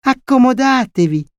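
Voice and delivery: high-pitched child playing host; inexperienced little boy. A narrator's reading. Small boy letting in an unknown lady, childish high voice. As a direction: offer it in a small boy's high voice. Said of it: "Camillo, inesperto com'era, disse".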